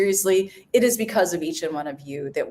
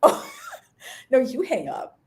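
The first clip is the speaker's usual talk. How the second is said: playful lovey no, you hang up; giggly late night call; 11 semitones higher than usual. Pretend. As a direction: tease like a couple on the phone. Mock couple hang-up game.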